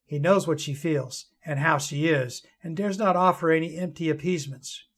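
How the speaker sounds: soft, low storytelling voice; story narration. A narrator's reading. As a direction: read it gently, like a fireside tale.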